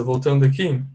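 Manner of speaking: ordinary talk, nothing marked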